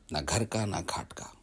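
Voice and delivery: whispered punchline of the proverb; whispered aside. Acted. Narrator whispers the end of his proverb to the audience. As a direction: drop to a whisper for the joke.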